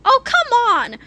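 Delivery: high-pitched whining protest; exasperated, high objection; indignant protest. A narrator's reading. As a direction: protest the unfair arrest. Accused characters protest arrest.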